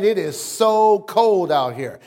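"so" drawn out for emphasis; stress on "so". Shown as a demonstration. Example of complaining about weather, stress on "so". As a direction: lean hard on "so" before "cold". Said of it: "put an emphasis on how cold".

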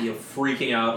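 ordinary delivery

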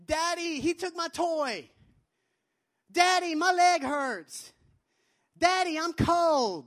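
put-on high, slow, whiny child voice with loud cries of Daddy; little daughter whining. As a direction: play a small girl whining to her dad. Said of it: "my three-year-old daughter will oftentimes run up to me and say".

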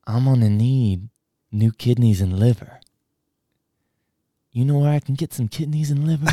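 slow, hazy stoner voice; stoned drawl. Retold or imitated. Stranger asking school worker for organs, slow hazy drawl. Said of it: "And he goes".

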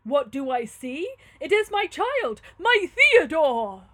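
slow, loud, soaring recognition; astonished cry. Acted. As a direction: build to a drawn-out cry of discovery. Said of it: "cried the holy man, starting".

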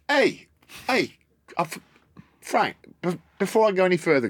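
high, eager hey hey to stop everyone; excited calling out. Retold or imitated. Dad stopping Gordon at the shop door, eager and high. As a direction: call them back before leaving.

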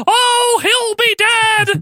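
very high gleeful wail, drawn out; hammed-up falsetto manager gloating; happy Paul Bearer impression. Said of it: "Paul Bearer rambles on about Undertaker".

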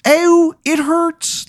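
put-on very high-pitched hurt child; little kid voice. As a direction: squeal it like a small child in pain. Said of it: "it's like a little kid voice".

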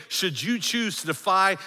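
high, emphatic proclamation; forceful warning. Acted. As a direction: proclaim it as a threat to all watching. Preacher voicing Rome's public warning.

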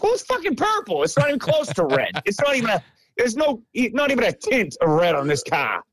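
sputtering outrage about the colour; fuming rant. Retold or imitated. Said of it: "walking toward my house fuming".

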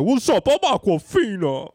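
high, loud, drawn-out celebrity impression; hammed-up high catchphrase voice. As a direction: shout the introduction way up high. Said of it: "but she'd be like".

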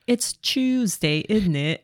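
put-on British accent. Co-host reading tweets mocking the British, British accent. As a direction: parody British speech, ending on a dropped "innit". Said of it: "The British be like".

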